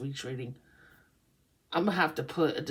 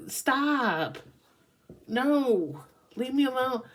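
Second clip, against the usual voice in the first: higher-pitched mock pleading to be left alone; acted-out exasperated whine; upset protest; 5 semitones higher, slower than usual. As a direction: fend them off, stressed and fed up. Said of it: "upset. No, Scorpio is like".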